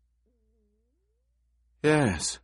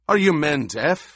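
raised, higher-pitched bark at the crew; barked command; 7 semitones higher, 5 dB louder than usual. A narrator's reading. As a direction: snap it loudly, like a leader rebuking his men. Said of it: "he barked".

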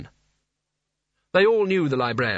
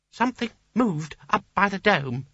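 lightly voiced nervous little wizard; timid small wizard; 7 semitones higher than usual.

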